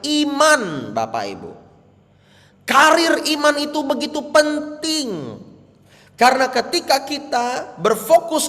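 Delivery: high, loud preaching climb; fiery preaching. Real, not acted. Pastor urging the congregation, loud and high. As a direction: preach it with full force.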